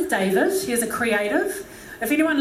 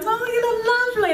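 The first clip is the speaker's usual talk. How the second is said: high, quick, sing-out praise; gushing compliment; 12 semitones higher, faster than usual. Acted. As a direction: gush it high and fast. Acting out paying a compliment.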